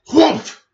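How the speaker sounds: loud, high booming whoomph; whoomph sound. A narrator's reading. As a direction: burst out the explosion noise. Rocket bursts through the pot.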